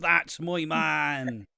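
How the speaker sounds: slow Irish farmer drawl; hammed-up rural Irish. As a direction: stretch it like a country farmer. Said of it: "that's fucking farmerish".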